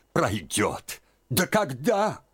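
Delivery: sharp high doubtful question; incredulous aunt. A narrator's reading. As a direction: doubt her, jump up high. (недоверчиво, высоко)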